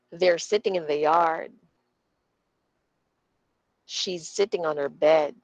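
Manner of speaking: "in the" and "on her" light, "sitting", "yard", "bed" stressed; quick unstressed words. Shown as a demonstration. Teacher repeating for students, light "in the" and "on her". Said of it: "one more time".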